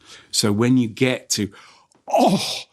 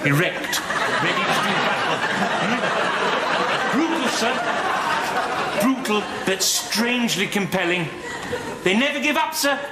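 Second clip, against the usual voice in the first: high, lewd tailor voice with laughter; leering tailor; 10 semitones higher than usual. Acted. Tailor describing a cockfight to a customer, high and leering. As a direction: leer through the innuendo, pitched up.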